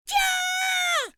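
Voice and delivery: very high, long loud shriek; scream. Acted. Emmy suddenly terrified in the forest, screaming. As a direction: scream at full pitch in terror. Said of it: "Emmy screams".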